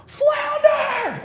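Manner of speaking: high, loud shouted nickname; coach yelling. Retold or imitated. Coach shouting the new nickname at him. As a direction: bellow the nickname across the gym. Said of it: "he's like".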